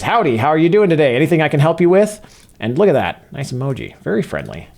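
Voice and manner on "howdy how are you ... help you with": a playful touch of a Southern twang, higher and louder; southern accent. Retold or imitated. Reading the chatbot's friendly reply aloud in a southern accent. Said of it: "it has determined is a southern accent".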